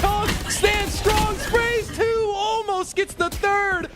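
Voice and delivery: high, slow shouted call; shouting caster. Real, not acted. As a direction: yell the big moment. Caster shouting big play.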